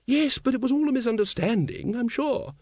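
very high, placating lady's voice; smooth it over in a high, ladylike voice; high-pitched reassurance; soothing lady. A narrator's reading. Ramkin soothes the sacked Vimes.

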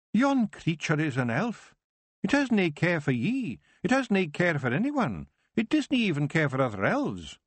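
put-on a wee Scots elder cautioning the girl; Scottish Feegle Kelder. The Kelder speaks, Scots dialect.

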